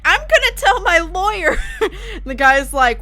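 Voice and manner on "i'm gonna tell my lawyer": high, loud child impression with a laugh; upset little kid. Streamer imitating the kid threatening his teammates, upset. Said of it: "then he's like".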